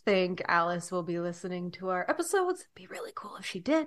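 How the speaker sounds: secretive whisper; real whispered aside. Real, not acted. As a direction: hush it like a confession. Hushed aside about Alice.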